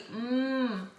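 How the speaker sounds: "Hum" as a long "mmm"; isolated M sound. Shown as a demonstration. Teacher showing the M sound, a held "mmm". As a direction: hum with lips closed, no vowel. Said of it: "Tem o M".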